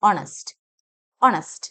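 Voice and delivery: ഓണസ്റ്റ് as "ON-ust", no H; initial O sound. Shown as a demonstration. Teacher repeats for learners, O sound first. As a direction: lead ഓണസ്റ്റ് with the O. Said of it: "ഓ sound ആണ്".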